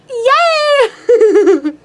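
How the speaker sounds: high squealed "Yay!"; giddy cheer; excited yay. Retold or imitated. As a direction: squeal with delight. Retelling joy at his wave.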